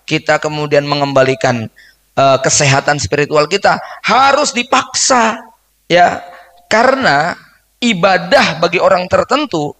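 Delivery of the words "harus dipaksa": higher and slow, each word weighed; insistent. Acted. Preacher urging the congregation, slow and higher. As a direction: press it out slowly, higher, as a must.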